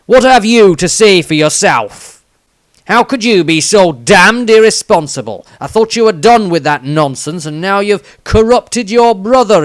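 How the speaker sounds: loud, high, outraged tirade; an enraged father berating his son; furious scolding. A narrator's reading. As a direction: tear into him like a furious father.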